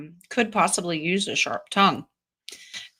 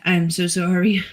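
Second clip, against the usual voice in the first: usual speaking voice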